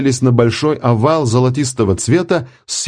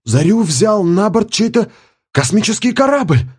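exclaimed; 12 semitones higher than usual. A narrator's reading. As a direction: shout it out in disbelief. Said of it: "воскликнул кто-то". (высокий изумлённый возглас)

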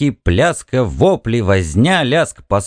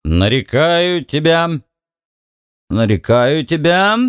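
put-on slow drunken mumbling, pitched up; slurred drunk speech; 5 semitones higher than usual. A narrator's reading. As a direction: slur it like a tipsy frat bro. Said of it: "заплетающимся языком бормотал Бикс".

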